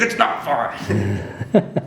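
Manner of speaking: drawn-out protest breaking into laughter; mock exasperation. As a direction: imitate a fed-up retort, very slow. Retells Steve's exasperated retort.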